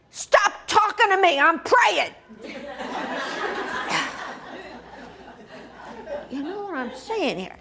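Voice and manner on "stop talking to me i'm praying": high, loud snap at an interrupter; mock-indignant yell, cutting him off; exasperated shout. Snapping at her husband mid-prayer.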